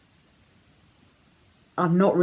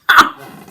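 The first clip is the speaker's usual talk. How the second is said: loud real laugh; 10 semitones higher, 13 dB louder than usual. Real, not acted. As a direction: burst of loud laughter, high and bright. Tells Alan's joke, bursts out.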